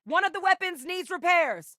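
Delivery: high, urgent callout; combat callout. Acted. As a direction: shout the warning across the field. Calling out in combat.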